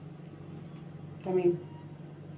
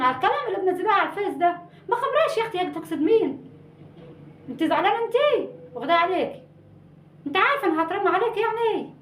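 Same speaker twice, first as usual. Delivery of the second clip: high, fussing auntie voice, higher throughout; fussy cousin on the phone; 5 semitones higher than usual. Acted. Cousin quizzing her relative about Miro's post, higher and fussing. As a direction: fret over the gossip in a much higher voice.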